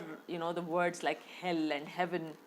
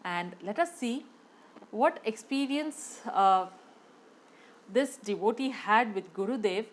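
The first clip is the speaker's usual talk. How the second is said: usual speaking voice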